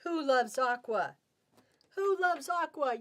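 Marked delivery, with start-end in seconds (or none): 2.0-3.0 sing-song higher excited call; higher excited repeat. Shown as a demonstration. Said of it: "Yes, we do".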